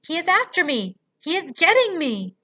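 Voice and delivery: high, very loud terror; panicked scream. A narrator's reading. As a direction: giant shrieking in a nightmare. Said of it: "screamed the flesh lump eater".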